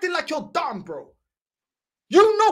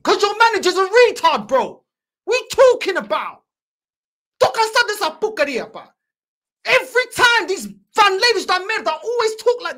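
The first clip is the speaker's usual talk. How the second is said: angry rant; 3 semitones higher, 4 dB louder than usual. Real, not acted. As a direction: shout them down in frustration. Fan ranting at his mates.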